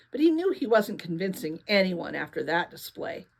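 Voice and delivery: ordinary delivery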